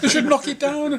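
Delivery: high, frantic wife voice, laughing through it; mimicking his wife. Husband retells wife begging for a toilet, high and frantic. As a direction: squeal it like the panicking wife.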